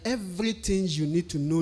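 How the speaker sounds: ordinary delivery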